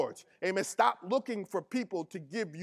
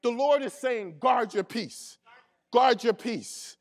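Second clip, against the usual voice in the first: louder, driving sermon delivery; full-force preaching; 2 semitones higher, 4 dB louder than usual. Acted. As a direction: preach it hard to the congregation.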